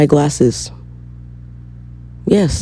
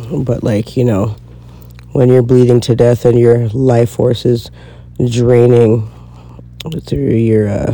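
usual speaking voice

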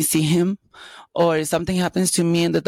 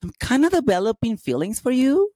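high nervous confession; 8 semitones higher than usual. Retold or imitated. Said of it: "I'm like".

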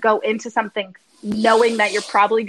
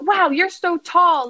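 mock amazement; 7 semitones higher, 4 dB louder, slower than usual. Doctor's retold amazed remark.

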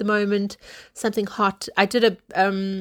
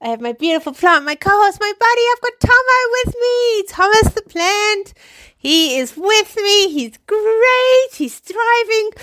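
high, loud, bouncy welcome; excited host intro; 13 semitones higher, 6 dB louder than usual. Acted. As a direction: greet listeners like a hyped host. Upbeat podcast host intro.

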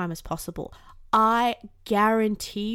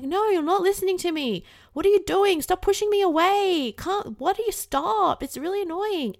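higher, louder whiny tantrum voice; inner toddler screaming; 9 semitones higher, 4 dB louder than usual. Pretend. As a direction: yell like a toddler throwing a fit at being ignored. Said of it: "make it scream even louder".

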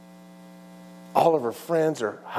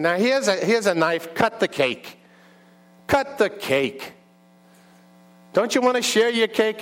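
high, insistent New York diner owner; New York urging, stretched out on the repeat; New York accent; 9 semitones higher than usual. Acted. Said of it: "have a New York accent".

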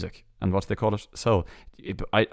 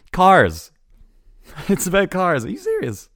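high, loud and laughing at how obvious it is; laughing disbelief that a film about cars is called Cars; incredulous mockery; 11 semitones higher, 7 dB louder than usual. Real, not acted. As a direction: squeal it in disbelief. Mocking the film title Cars.

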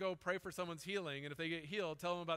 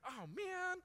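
mock dismay; 12 semitones higher than usual. Regret at missed chance.

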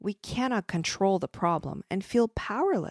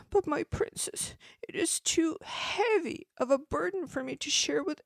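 miserable whisper; 11 semitones higher than usual. Acted. Boy tells the princess his burden is too heavy, miserable whisper. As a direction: whisper it as a miserable small child. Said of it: "The child answered miserably".

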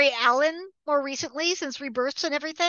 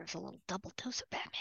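whisper; 5 semitones lower, 18 dB quieter, much faster than usual. Real, not acted. Aside whispered to listener.

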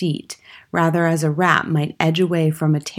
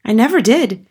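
high, louder protest; exclaimed denial; 6 semitones higher, 6 dB louder than usual. A narrator's reading. Accused boy denies stealing the cake, exclaiming. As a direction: cry out your innocence. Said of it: "the boy exclaimed".

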